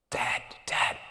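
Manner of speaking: breathless whispered call to dad; hushed eager calling. Retold or imitated. Daughter rushing in to tell dad, whispered.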